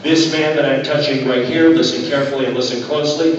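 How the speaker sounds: normal talking voice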